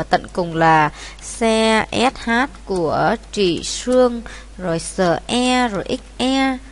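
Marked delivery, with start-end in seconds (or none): none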